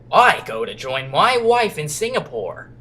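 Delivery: slow, put-on American accent. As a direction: play the captain with a hammy American accent. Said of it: "He's gonna sound American".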